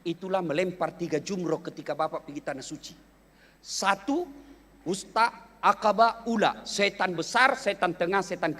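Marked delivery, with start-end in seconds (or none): none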